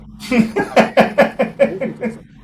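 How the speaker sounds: loud, high burst of laughter; infectious high cackle of amusement; real laugh. Real, not acted. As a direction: crack up at the comedian's story. Interviewer cracks up at self-deprecation.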